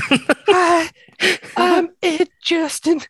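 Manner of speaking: very high, slow squeaky put-on voice; Ed Justin voice. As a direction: squeak it out like a goofy stranger. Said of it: "Read it in your best Ed Justin voice".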